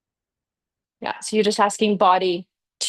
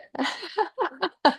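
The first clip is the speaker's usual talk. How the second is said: laugh; 8 semitones higher, 5 dB quieter than usual. Real, not acted. Laughing at own remark.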